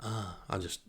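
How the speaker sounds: ordinary delivery, nothing marked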